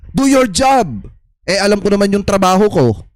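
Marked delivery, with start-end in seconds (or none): 0.1-1.1 mildly raised, higher imitation of a shouted order; a light hint of a shouted command; mock shout. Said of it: "sigawan ka".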